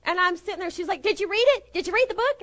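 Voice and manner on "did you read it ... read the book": high-pitched, insistent questions; imitated eager friend. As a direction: pester her excitedly, pitched way up. Eager friend pressing her about book.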